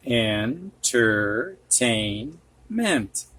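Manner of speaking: Entertainment. stretched syllable by syllable; very slow syllables. Shown as a demonstration. Teacher to students, sounding out the word very slowly. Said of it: "teacher modelling word reading".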